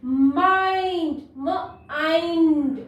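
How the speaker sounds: Mind drawn out slowly, twice; stretched-out teacher's mind; slow modelled word. Shown as a demonstration. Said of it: "modelling word reading".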